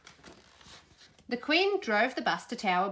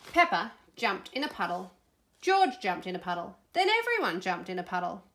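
plain reading voice

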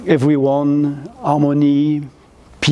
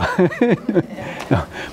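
soft laugh; 4 semitones higher, 4 dB quieter than usual. Real, not acted. Laughs after own joke.

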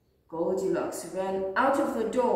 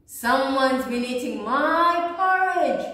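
growling; 4 semitones higher, 7 dB louder than usual. A narrator's reading. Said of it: "growled Papa Bear".

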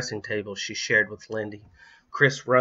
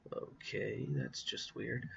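muttered under the breath; quiet aside; 16 dB quieter, slower than usual. Real, not acted. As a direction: drop to an aside. Reader aside, much quieter.

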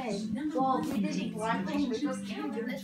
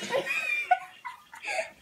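high burst of laughter. Real, not acted. Showing the blindfold makeup.

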